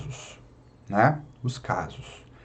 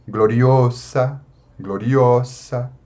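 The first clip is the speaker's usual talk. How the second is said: gloriosa drawn out slowly, stress on o: glo-ri-O-sa; slow stress demo; much slower than usual. Shown as a demonstration. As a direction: spell out gloriosa slowly, leaning on O. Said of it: "A penúltima é o". (gloriosa bem devagar, tônica no O)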